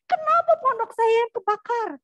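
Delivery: loud, high-pitched angry complaint; angry protest. Retold or imitated. Castaway whose hut burned rails at God, angry protest. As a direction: rail at heaven, acted at full volume. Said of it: "dia marah sama Tuhan".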